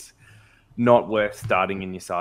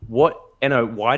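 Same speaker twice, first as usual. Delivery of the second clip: loud; 3 semitones higher, 7 dB louder than usual. Real, not acted. Mock outrage at the graphic.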